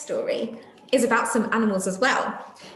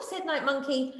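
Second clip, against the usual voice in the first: lively storybook reading voice; reading to children; 4 semitones higher than usual. A narrator's reading. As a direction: read it out like a picture book for little ones. Reading a picture book aloud to children.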